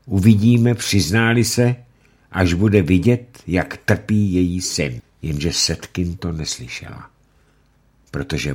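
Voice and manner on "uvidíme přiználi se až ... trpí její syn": louder, higher cold threat; cruel judge. Acted. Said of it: "Rychtář řekl".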